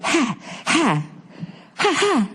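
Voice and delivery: high, punchy huffing gasps; heavy-bag huffs. Retold or imitated. Friend with burned mouth, high sharp huffs. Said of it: "sounded like he was hitting a heavy bag".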